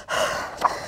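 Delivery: long unvoiced sigh; tired sigh. Tired Leo sighs at the workload. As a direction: let out a weary breath. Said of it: "Leo sighed".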